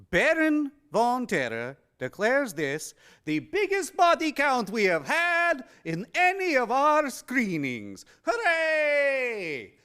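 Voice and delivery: high, loud proclamation ending in a cheer; showy horror-host announcement. Acted. Horror host declares the record body count to the audience, showy and high. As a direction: declare it grandly, pitched well up.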